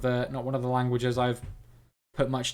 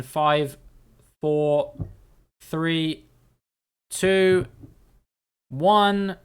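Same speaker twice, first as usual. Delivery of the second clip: countdown; 3 semitones higher, 4 dB louder, much slower than usual. Real, not acted. Counting down the guessing.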